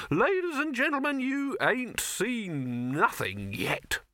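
high, slow, booming showman build-up; excited showman. A narrator's reading. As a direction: sell the big finish to the crowd.

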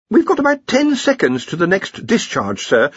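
high-pitched, anxious underling; nervous Ponder. A narrator's reading. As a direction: warn the boss in a worried high voice. Ponder in story.

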